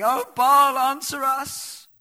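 a huge, high, slowed cry to a silent idol; prophets crying to Baal. Acted. Prophets of Baal begging their god in a high, loud, drawn-out cry. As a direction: wail it out like a desperate crowd.

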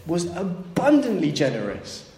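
high, slow wondering voice; lightly voiced son in awe; son's realization. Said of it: "he goes".

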